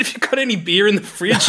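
giggling while retelling dad's beer question; laughing. Real, not acted. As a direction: fight back laughter as you quote him.

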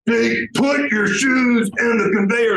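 high, slow, yelled command with a hint of accent; mock screaming guard. KGB-looking guard yelling at a passenger, slow and high. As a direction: bark it like an angry security guard. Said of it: "Russian is screaming".

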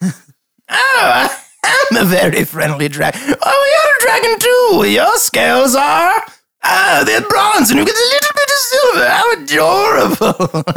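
very high, squealing delight; gushing lord. Acted. Lord discovers a fellow dragon, delighted and gushing. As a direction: coo extravagantly, stretching words in a high swoop.

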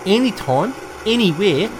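hammed-up New Zealand accent, selling a taxi service; New Zealand accent, taxi ad pitch with bro and cuz; Kiwi accent. Host voicing a Kiwi taxi advert in New Zealand accent. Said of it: "Do you think your Auckland accent is good, bro?".

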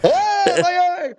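hammed-up high cry of pain; Harrison Ford shout. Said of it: "Harrison Ford style".

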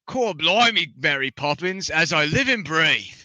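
hammed-up British accent, going pirate. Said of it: "your best British accent".

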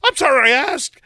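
high, loud mock outburst; imitating Sarah. Sarah about to hang up on killer, high mock outburst. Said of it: "she immediately is like".

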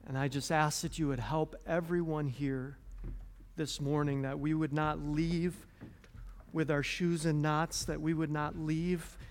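soft, earnest prayer voice; closing prayer. Acted. Pastor closing the sermon in soft earnest prayer. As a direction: pray quietly over the church.

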